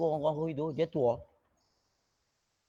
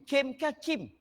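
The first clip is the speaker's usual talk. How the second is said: much higher-pitched checking of "Kim"; insistent questioning; 10 semitones higher, much slower than usual. Retold or imitated. Pupil checking the word with others, high insistent questioning. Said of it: "saya pergi tanya orang itu".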